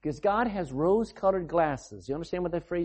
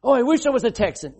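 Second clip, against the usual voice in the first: fast, louder, higher mock longing; mock wistful wish; 6 semitones higher, 7 dB louder, faster than usual. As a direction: ham up a silly wish, quick and bright. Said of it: "You say".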